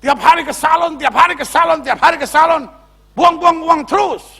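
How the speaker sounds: mock husband's tirade, jumping up high; high-pitched acted scolding; angry husband. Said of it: "Bapak marah dulu".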